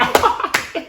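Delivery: loud, high mock talk-show laugh; big phony guffaw; fake laugh. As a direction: laugh it up, exaggerated and put on. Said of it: "fake laugh at each other".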